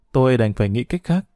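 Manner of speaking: ordinary delivery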